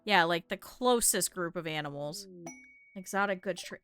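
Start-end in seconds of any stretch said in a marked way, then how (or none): none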